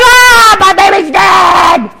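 high, loud hammed-up wail; bawling mother. Mother crying over dead daughter, mock bawling. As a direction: shriek and wail like a grieving mom. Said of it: "the kid's mom's bawling".

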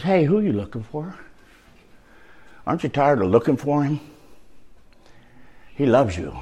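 soft tender reassuring voice; gentle Jesus. Retold or imitated. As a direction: soothe the seeker gently.

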